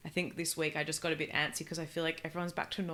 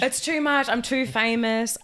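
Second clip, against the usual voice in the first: mock diva; 3 semitones higher, 7 dB louder, slower than usual. Joking fake celebrity.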